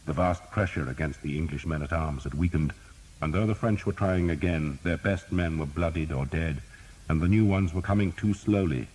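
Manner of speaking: ordinary delivery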